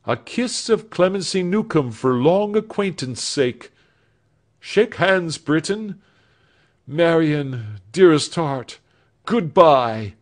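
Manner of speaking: Alfred voiced with hopeful fervour; earnest young man. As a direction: promise the future with feeling. Alfred's last goodbyes.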